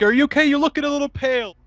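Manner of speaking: high-pitched teasing imitation; mock concern, roasting. Man half roasting the other guy, mock concern. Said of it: "half roasting him".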